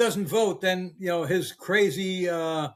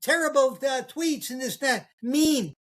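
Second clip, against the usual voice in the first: high, whiny mock voice of offended liberals; mocking complainers; 6 semitones higher than usual. Mocking women who dislike Trump's talk, high mock whine. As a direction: lightly parody someone clutching pearls. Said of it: "They're the ones who don't like the way Trump speaks".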